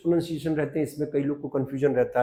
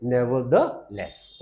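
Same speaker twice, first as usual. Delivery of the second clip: very slow demo; 3 semitones lower, much slower than usual. Shown as a demonstration. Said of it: "फिर भी को क्या बोल देते हैं".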